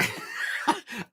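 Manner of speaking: laugh. Real, not acted. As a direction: chuckle quietly at the teasing. Laughs while joking with chat.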